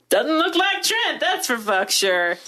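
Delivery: high, drawn-out mock dismay; mock disappointed reaction. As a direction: exaggerate the letdown, slow and high. Acted group reaction to actor's looks.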